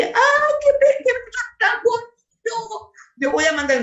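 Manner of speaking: high, sugary swooning voice; mock gushing listener. Preacher mocking a listener who wants a pleasant message, high and gushing. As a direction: gush like a churchgoer hearing a sweet message.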